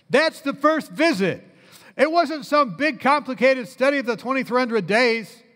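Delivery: high-pitched, punchy sermon delivery; rousing preacher's emphasis; emphatic preaching. Real, not acted. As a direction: drive the point home to a congregation. Preaches the point with force.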